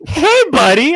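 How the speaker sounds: high, loud mock-chummy greeting; friendly customer. Customer answering Aaron on the phone, loudly chummy. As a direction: imitate a customer greeting a pal. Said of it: "they don't get angry because it's Aaron".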